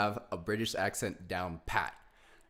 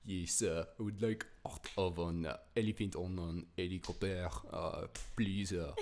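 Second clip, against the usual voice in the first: dropping the H, hammed-up French English; French accent. Shown as a demonstration. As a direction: play a tourist in a comic French accent. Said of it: "if you talk like this a bit".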